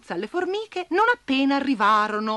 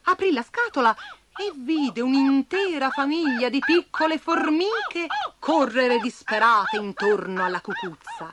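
ordinary delivery, nothing marked

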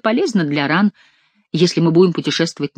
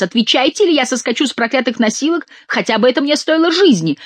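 high, desperate threat; urgent cry demanding an answer; shouting; 7 semitones higher than usual. A narrator's reading. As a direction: shout after her, pitched up throughout. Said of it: "кричал голос".

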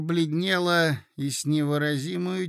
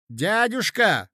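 loud, drawn-out cry, pitched up; exclamation; 6 semitones higher, 6 dB louder, slower than usual. A narrator's reading. Mozglyakov interrupts his uncle, loud exclamation. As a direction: call out in protest, stretching the word. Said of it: "вскричал Мозгляков".

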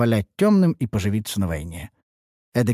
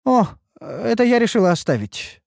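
high, sweetly innocent reply; 11 semitones higher than usual. Acted. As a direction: play innocent, voice lifted high. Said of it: "откликнулась Ульданта невинным голосом". (невинным голоском)

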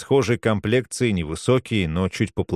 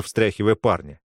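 plain reading voice

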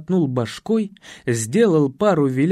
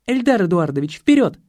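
командующая Татьяна; 3 semitones higher than usual. Acted. (бодрая команда высоким голосом)

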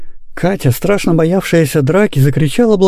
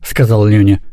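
plain reading voice

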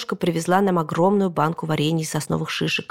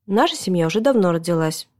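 ordinary delivery

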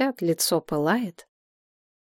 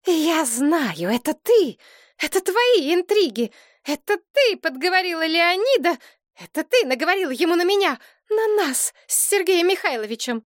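accusing outburst; 11 semitones higher than usual. A narrator's reading. Elena accuses her daughter.

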